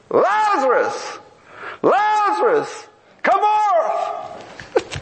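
slow, high calling out to the tomb; Jesus calling Lazarus from the tomb, slow and high. Acted. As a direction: call him out of the grave, drawn out.

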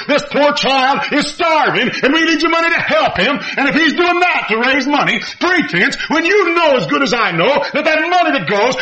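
driving, high-pitched pulpit delivery; impassioned preaching. Real, not acted. Preacher denouncing pretenses to raise money, forceful preaching. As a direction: preach it high and insistent, phrase after phrase.

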